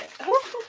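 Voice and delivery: a quick high giggle; short laugh. Real, not acted. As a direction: laugh it off, amused at yourself. Laughing at own sticker hoard.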